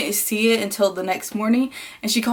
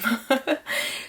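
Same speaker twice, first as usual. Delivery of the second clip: short high laugh, rising; high little chuckle; 5 semitones higher than usual. Real, not acted. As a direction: end on a small real laugh. Laughs after quoting her sister.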